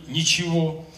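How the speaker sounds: normal talking voice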